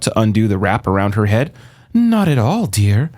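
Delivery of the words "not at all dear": high, sugary denial; sweet false reassurance. A narrator's reading. Medusa sweetly denying danger to Annabeth. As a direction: brush off the worry sweetly.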